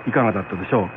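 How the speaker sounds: ordinary delivery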